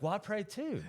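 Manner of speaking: high-pitched comic protest; mock indignation. Retorting to wife whose prayer worked, comically mad. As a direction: whine it as a put-out retort. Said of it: "I'm mad".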